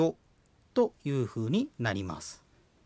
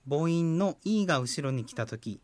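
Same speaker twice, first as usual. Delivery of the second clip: ordinary delivery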